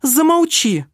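sharp high outcry, loud; exclaiming. A narrator's reading. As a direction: cut him off with a cry. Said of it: "воскликнула она".

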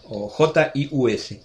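slow, deliberate letter by letter; spelling it out. Shown as a demonstration. Settling the spelling for his friend, slowly. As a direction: spell J I U S one letter at a time. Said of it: "G, no".

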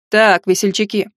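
ordinary delivery

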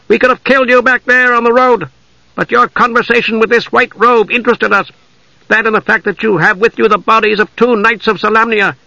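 high-pitched, formal elf captor; hostile elf warrior. Acted. Elf warrior questions captured knight, high and hostile. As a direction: hold a high, challenging elf voice.

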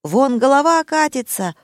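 mock panic, wailing high; frantic distant cries. A narrator's reading. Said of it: "истошные крики". (истошные крики о помощи)

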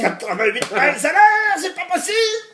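frantic high-pitched scientist shout; mad scientist. Pretend. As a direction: cry it out like a frenzied inventor. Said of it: "le scientifique fou".

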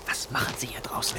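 hushed night whisper; startled whisper outside at night. Acted. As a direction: keep it secret in the dark.

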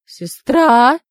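loud, rising stunned question; shocked. A narrator's reading. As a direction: blurt it out in disbelief. Learns she is his sister.

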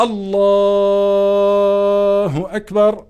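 الله stretched very long, then أكبر after a pause; drawn-out Allah. Shown as a demonstration. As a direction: hold the word الله long while forming the intention. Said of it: "لأنه مده".

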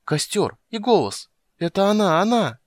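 high, rising excitement; high-voiced verge of hysterics; overjoyed, near tears. A narrator's reading. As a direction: exclaim with growing joy and relief. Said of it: "едва не пустив слезу от восторга и умиления".

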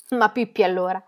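plain reading voice

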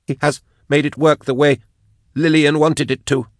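high, compelled captive voice; unwilling confession. A narrator's reading. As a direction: praise it in a forced high voice. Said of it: "coming out anyway".

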